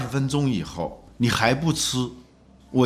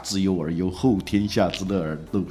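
recited classical text; 3 semitones lower than usual. Acted. Host reciting Fan Zhongyan's famous lines, solemn recital. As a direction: intone it like reading a classic aloud. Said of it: "范仲淹的名言是".

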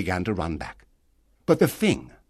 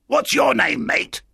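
high, louder castaway voice; strange castaway; 4 dB louder than usual. Acted. Castaway asking the newcomer's name, strange high voice. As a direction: call it out high and odd.

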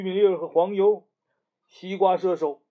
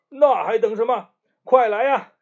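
higher, louder eager call to hurry; 6 semitones higher, 6 dB louder than usual. Acted. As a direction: urge a friend to come quickly. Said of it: "大嘴花说".

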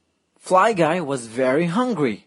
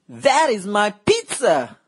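put-on girl yelling; 7 semitones higher than usual. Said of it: "A girl yelled".

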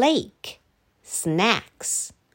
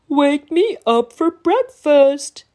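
dinosaur voice; 5 semitones higher, 5 dB louder than usual. Acted.

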